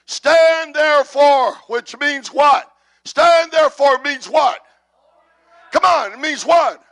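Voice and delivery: high, emphatic preaching shout; rousing call-out. Real, not acted. Preacher quizzing the congregation on the verse, rousing shout. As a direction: press the crowd for an answer.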